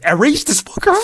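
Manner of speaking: high-pitched, loud demand; angry outburst. Pretend. Fan demanding a hated character be removed, angry outburst. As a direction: explode in hammed-up rage.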